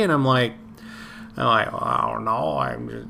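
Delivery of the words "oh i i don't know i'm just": put-on groggy old grandpa voice; lightly voiced sleepy old man. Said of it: "were you an old grandpa back then".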